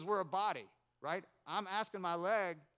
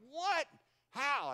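high, loud yelps of disbelief; astonished outburst; 9 semitones higher, 7 dB louder than usual. Retold or imitated. Brother tasting the homegrown orange, astonished. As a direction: blurt each word out, stunned.

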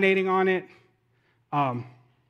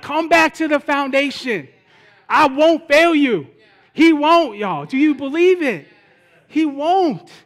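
raised, emphatic proclamation; impassioned preaching; 8 semitones higher, 8 dB louder, slower than usual. Real, not acted. Preacher voicing God's promise to congregation, impassioned. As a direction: drive the promise home to the crowd.

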